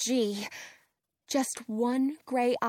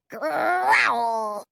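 growling like a dog; 4 dB louder than usual. Acted. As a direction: bark it out as a dog. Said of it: "Prince barks".

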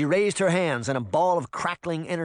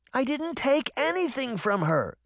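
high-pitched indignant denial; crying out; 13 semitones higher than usual. A narrator's reading. Sabrina denying the witch's charge, crying out. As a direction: protest in a high girl's voice. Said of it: "Sabrina cried".

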